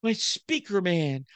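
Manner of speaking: very high, gushing falsetto as his wife; hammed-up high adoring wife voice; mock adoring wife. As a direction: overdo a swooning, adoring wife for laughs. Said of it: "my wife looks at me and says".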